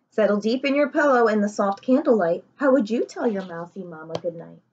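ordinary delivery

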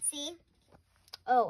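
usual speaking voice

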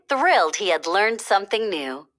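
usual speaking voice

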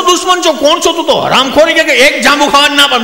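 high, loud indignant scolding; comic indignant host; mock scolding. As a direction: tell him off for refusing. Host scolding refusing friend.

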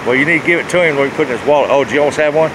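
usual speaking voice

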